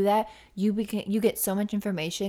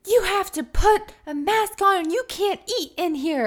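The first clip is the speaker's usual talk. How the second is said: mock store worker; 11 semitones higher, 4 dB louder than usual. Store worker telling the sisters to mask up, mock bossy high voice. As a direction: parody a store worker ordering them about. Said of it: "he goes".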